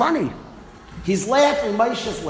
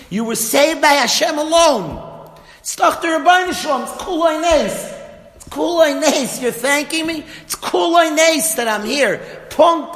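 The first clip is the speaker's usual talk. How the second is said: louder and higher, hammering each claim; emphatic insistence; 7 semitones higher, 4 dB louder than usual. Retold or imitated. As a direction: drive it home like a preacher. Moshe insists it was Hashem.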